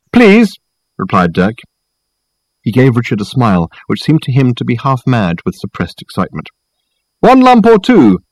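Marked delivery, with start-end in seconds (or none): 0.1-0.5 high, loud reply; calling back. A narrator's reading. Dirk replying to Reg in the kitchen, calling back. 7.2-8.2 high, loud, drawn-out call. A narrator's reading. Reg asking about sugar from the kitchen, calling out. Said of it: "called Reg again".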